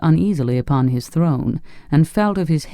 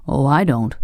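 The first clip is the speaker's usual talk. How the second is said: usual speaking voice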